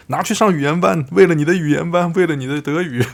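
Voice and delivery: excited mock rallying calls; cheering on. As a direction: urge it generously as a cheering table. Players throw chips for her classes.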